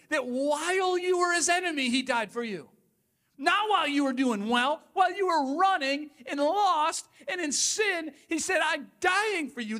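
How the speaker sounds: high, loud preaching; preaching at full force. Acted. Pastor proclaims to the church, loud and high. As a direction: lift it to a passionate peak.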